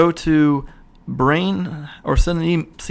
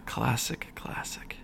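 unvoiced whisper fading out; soft whispered classic; whispered aside; 3 semitones lower, 12 dB quieter, slower than usual. Real, not acted. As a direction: breathe it to yourself. After laughing at the movie.

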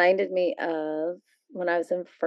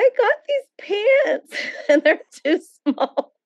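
retold crying; 3 dB louder than usual. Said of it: "I was crying to my mom. I was like".

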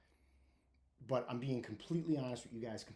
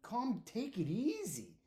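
high, slow, drawn-out mock concern; a high, slow, lightly mocking friend's voice; imitating buddy; 7 semitones higher, slower than usual. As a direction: coax a hurt friend to settle down, hamming it up. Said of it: "He's like".

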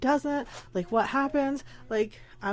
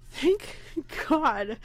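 relieved; 4 semitones higher than usual. Retold or imitated. Relief at normal test results.

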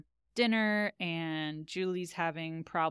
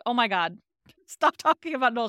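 exasperated outburst, higher and louder through a laugh; fed up; 5 semitones higher, 8 dB louder, faster than usual. Retold or imitated. Recapping Julie snapping at Felicity, mock exasperated. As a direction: Julie losing patience, voiced with a laugh. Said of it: "like, fed up".

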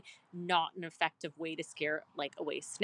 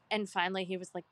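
ordinary talk, nothing marked

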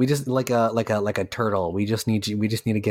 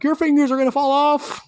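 mock scream; 4 dB louder than usual. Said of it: "is probably screaming at me like".

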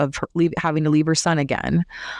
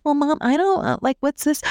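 put-on higher, airheaded girl voice; a lightly mocked ditzy daughter; clueless daughter; 10 semitones higher than usual. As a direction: play the confused kid who has no idea. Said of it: "And Ashley's like".

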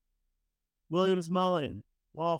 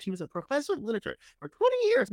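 mock gushing; 10 semitones higher, 3 dB louder, faster than usual. Mock-retelling her self-description.

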